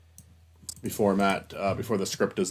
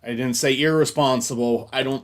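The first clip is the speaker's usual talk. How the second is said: loud frustration; 9 dB louder than usual. Real, not acted. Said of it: "God, that better not be my last fucking take".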